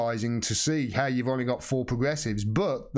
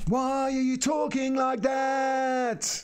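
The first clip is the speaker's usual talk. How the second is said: exaggerated ranting TV host; mock yelling presenter; 11 semitones higher, much slower than usual. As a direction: ham up a shouty presenter yelling at the camera. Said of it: "He keeps yelling at the screen like this".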